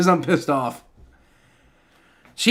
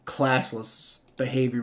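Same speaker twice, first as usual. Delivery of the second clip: low, slow scornful echo; 4 semitones lower, 8 dB quieter, slower than usual. Real, not acted. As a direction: spit the phrase back in contempt. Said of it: "my ass".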